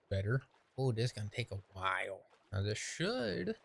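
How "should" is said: "should" drawn out and pitched high; stress on SHOULD. Real, not acted. Player doubting the track will turn, stress on "should". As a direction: lean slowly on "should", lifting it high. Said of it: "keyword should".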